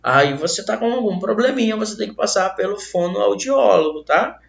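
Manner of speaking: ordinary talk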